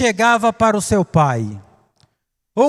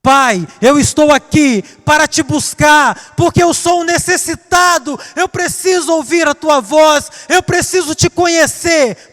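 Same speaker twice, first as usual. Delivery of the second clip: fervent prayer; 6 semitones higher than usual. Acted. The preacher praying to God as a needy son, fervent and rising. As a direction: pour it out like a desperate prayer.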